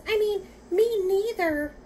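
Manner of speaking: slow, very high little mouse voice; tiny little voice. A narrator's reading. Tiny mouse correcting itself to the boars, tiny little voice. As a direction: squeak it out loud and drawn out. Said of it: "said a tiny little voice".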